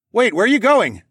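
loud, high shout across distance; calling out. A narrator's reading. Rider calling to phoenix over the snow, shouting. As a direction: call after someone flying away. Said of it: "I called out over the snow".

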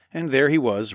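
usual speaking voice

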